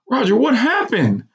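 high, tearful mother's question; mother crying on the phone; sniffling. A narrator's reading.

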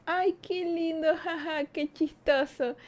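high, slow, gushing voice; mock sweet. The woman mocks her own flattered reaction to her ex. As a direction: play it like a flattered girl. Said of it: "yo creí como que".

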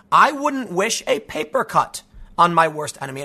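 ordinary talk, nothing marked

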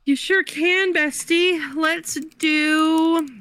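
high, singsong bestie voice; sweetly over-eager helper; playfully obliging. Pretend. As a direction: agree in a high, put-on chummy voice. Playfully obliging a word request again.

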